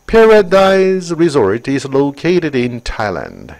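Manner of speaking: very slow, clear English read; slow textbook reading; slow reading aloud. Shown as a demonstration. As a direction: read it out word by word for the class. Reads English sentence aloud.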